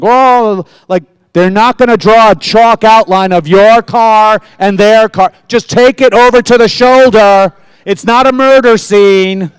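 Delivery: exasperated rant. Retold or imitated. Driver scolding a fender bender blocking the road. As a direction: scold them for blocking traffic.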